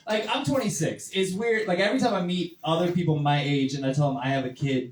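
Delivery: normal talking voice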